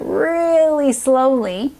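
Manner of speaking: slow, elongated emphasis on really slowly; drawn-out. Shown as a demonstration. Teacher explains how coach reads the word, drawn-out. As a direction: stretch REALLY right out. Stretches the words to illustrate slowness.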